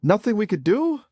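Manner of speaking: indignant echo. Acted. AI prophet mocks surrender.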